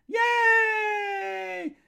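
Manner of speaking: high loud yay; squealed yay. Real, not acted. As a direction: squeal with delight.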